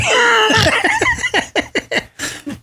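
real laughing fit; big laugh. Real, not acted. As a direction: crack up at yourself. Laughing at own fight story.

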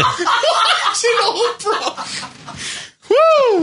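high, slow words through real laughter; laughing. Real, not acted. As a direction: repeat it while cracking up. Host cracks up repeating caller's line.

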